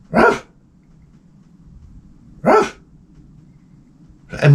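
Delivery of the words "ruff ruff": short, high yaps, evenly spaced; steady rhythmic dog bark; imitated dog bark. Comic imitating his dog's rhythmic barking, high yaps. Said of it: "a very rhythmic bark".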